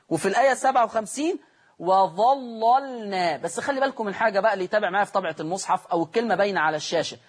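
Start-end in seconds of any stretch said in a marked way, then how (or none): 1.8-3.4 وظللنا recited slowly with heavy first lam; slow Quranic recitation; tajweed recitation. Shown as a demonstration. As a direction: stretch وظللنا, thick doubled lam. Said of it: "اللام الأولانية ... هي دي اللي هتغلظ".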